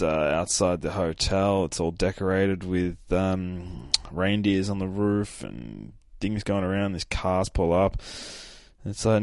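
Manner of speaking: ordinary talk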